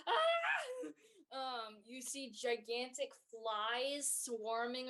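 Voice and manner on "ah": put-on high frightened squeak of a cowering kid; scared yelp. Cowering kids squeak at the chaos in fright.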